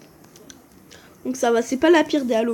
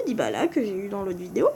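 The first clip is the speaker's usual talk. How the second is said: usual speaking voice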